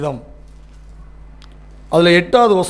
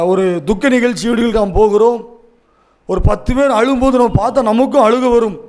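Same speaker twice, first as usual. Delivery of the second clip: normal talking voice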